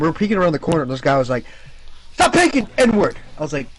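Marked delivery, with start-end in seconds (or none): 2.1-3.2 high, loud outburst imitating an angry gamer; retold in-game shouting; mock angry yell. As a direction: yell it like a furious player caught off guard. Retold angry player yelling in game.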